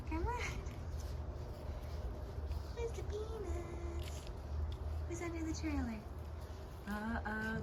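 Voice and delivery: hushed gentle coaxing; soft coaxing. Real, not acted. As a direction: softly coax shy cats out. Coaxing scared cats.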